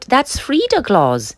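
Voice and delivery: high, slow alarmed cry. Acted. Mother spotting Frida in the cat's jaws, alarmed cry. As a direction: gasp it out in alarm. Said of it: "Mother cried out".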